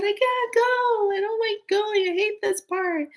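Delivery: put-on very high, slow tearful child's plea; crying child. She pleads with papa not to make her leave, very high and tearful. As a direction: wail it like a little girl who can't bear leaving. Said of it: "I would just start crying".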